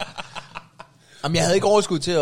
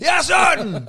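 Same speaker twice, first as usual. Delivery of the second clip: high, loud insistence; retold angry shout; 14 semitones higher, 7 dB louder, much slower than usual. Neighbour says he saw the bag, shouting angrily. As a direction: yell it as an eyewitness accusing someone. Acted quarrel with dog owner.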